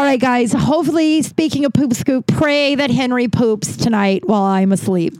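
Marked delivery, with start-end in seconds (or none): none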